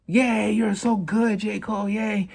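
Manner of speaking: high, slow mock fan cheer; gushing fans. Fans praising everything J. Cole drops, high and cooing. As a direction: coo like a starstruck fan. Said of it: "everybody's just kinda like".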